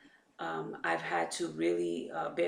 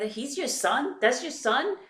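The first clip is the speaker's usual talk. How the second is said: surprised passersby; 7 semitones higher, 5 dB louder than usual. Retold or imitated. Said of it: "and they're like".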